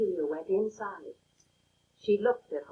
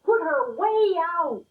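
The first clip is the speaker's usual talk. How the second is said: slow, higher, louder demand; shouting; 7 semitones higher, 5 dB louder than usual. A narrator's reading. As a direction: drag the words out in an angry yell. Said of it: "they shouted".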